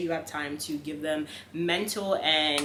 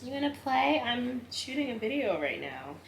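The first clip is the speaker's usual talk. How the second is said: higher, playful scolding to the puppy; higher playful words to the dog; pet-talk to dog; 7 semitones higher than usual. Real, not acted. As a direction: tease the dog gently.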